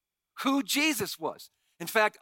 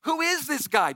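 skeptical crowd; 7 semitones higher, 3 dB louder than usual. Retold or imitated. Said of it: "people are asking".

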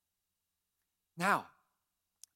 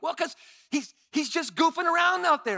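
higher and louder, heated justification; snapping back in defence of the yelling; irritated coach dad; 10 semitones higher, 8 dB louder than usual. Retold or imitated. Dad defending yelling to wife, heated louder voice. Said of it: "I'm like".

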